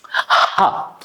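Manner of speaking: ha as a pushed breath from the lungs; breathy h demo. Shown as a demonstration. Teacher showing the breathy ha to learners. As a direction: push ha out from the belly. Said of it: "So what you do it".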